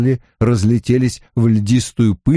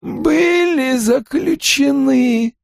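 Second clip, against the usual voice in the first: put-on high, slow eerie old voice; Aginor; much slower than usual. A narrator's reading. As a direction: ancient villain speaking slowly. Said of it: "улыбнулся Агинор".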